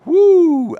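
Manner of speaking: very high, loud cheer; excited whoop. Retold or imitated. Gambler cheering the upbeat waitress, loud high whoop. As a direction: let out a big delighted whoop. Cheering at her good energy.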